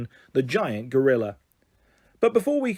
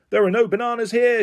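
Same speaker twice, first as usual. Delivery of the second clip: high-pitched storybook shout; raised, exasperated protest; 8 semitones higher, 4 dB louder than usual. A narrator's reading. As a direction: shout it up at a giant. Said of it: "shouted Terry".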